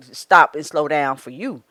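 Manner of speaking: usual speaking voice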